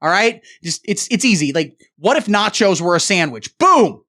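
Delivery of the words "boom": shouted boom. Acted. Punchline exclamation.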